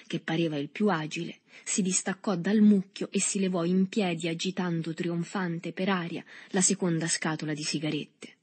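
ordinary delivery

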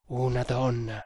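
understated, astonished exclamation; surprised. A narrator's reading.

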